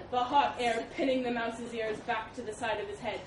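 plain reading voice

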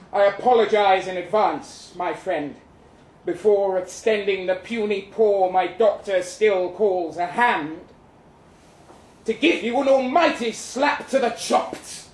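normal talking voice

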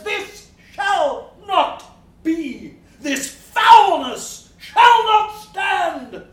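slow, loud, high proclamation; solemn declaration. Acted. Sir John Paternoster voicing his conscience to the gentlemen, slow and loud. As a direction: hammer each word out slowly like a verdict.

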